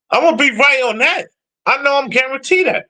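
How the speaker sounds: ordinary talk, nothing marked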